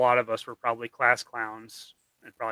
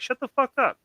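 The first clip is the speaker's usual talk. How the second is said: a sharp retort jumping much higher in pitch; mock snap back; 10 semitones higher than usual. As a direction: shut down the bully with a quick put-down. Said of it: "and they're like".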